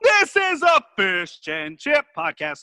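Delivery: slow, big announcer voice. Acted. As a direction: draw out the show name. Hammed-up show intro.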